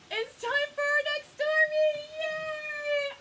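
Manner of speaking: high, loud cheer; super excited. Real, not acted. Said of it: "I am super".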